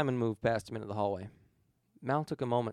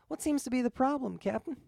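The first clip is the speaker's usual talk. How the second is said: amused; 12 semitones higher than usual. Acted. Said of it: "laughing slightly at Simon's".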